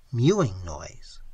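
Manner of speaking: ordinary delivery, nothing marked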